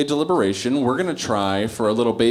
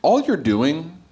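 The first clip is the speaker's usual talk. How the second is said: usual speaking voice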